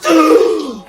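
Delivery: sharp high gasp; shocked gasp. Acted. Stunned by the answer, a sharp high gasp.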